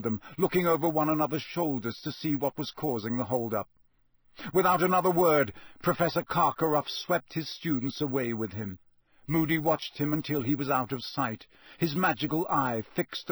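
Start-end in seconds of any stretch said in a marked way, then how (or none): none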